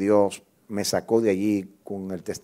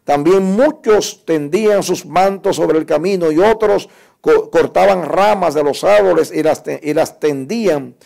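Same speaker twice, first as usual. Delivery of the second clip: loud, high-pitched declaiming of a Bible passage; forceful scripture reading; 8 semitones higher, 9 dB louder than usual. Acted. Preacher reading Marcos once to the congregation, loud proclamation. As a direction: proclaim the Gospel at full force, raised and ringing.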